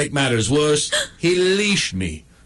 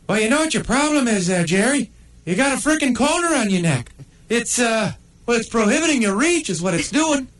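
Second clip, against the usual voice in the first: a much higher, cheeky little pug voice; sly neighbor pug, high and teasing; 9 semitones higher, faster than usual. Acted. Pug Buzz needles the coned dog, high and cheeky.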